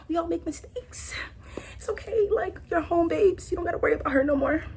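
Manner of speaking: soft, hushed comfort; soft reassuring. Retold or imitated. Girlfriend comforts her crying man, soft hush. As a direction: hush him like a child. Soothing him, self-mocking.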